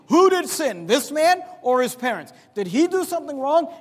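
loud, high-pitched demanding questions; insistent questioning. Retold or imitated. Disciples asking Jesus who is to blame, insistent. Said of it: "And they're asking Jesus".

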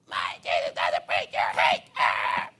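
loud, distorted drawn-out scream; hammed-up furious yell, ending in "ah"; mock screaming. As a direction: imitate a raving heckler at full volume. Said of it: "You're screaming at me".

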